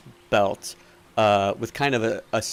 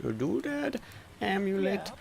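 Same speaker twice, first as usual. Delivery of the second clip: higher, softer merchant repeating an order; doddery shopkeeper, pondering; 10 semitones higher, 6 dB quieter than usual. Acted. Shopkeeper taking a customer's order, pondering. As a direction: mull over each item haltingly.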